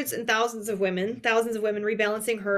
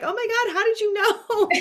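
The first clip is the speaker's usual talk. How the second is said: high-pitched squeal of surprise, laughing through it; delighted disbelief; 11 semitones higher, 4 dB louder than usual. Retold or imitated. Host imitating guest's delighted surprise at being recognized, high and laughing. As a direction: shriek it like you've just been caught out.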